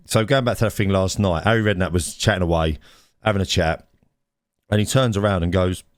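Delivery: ordinary talk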